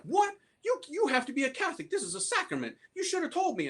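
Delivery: mad priest. Retold or imitated. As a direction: squeal it out like an outraged priest. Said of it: "he got mad".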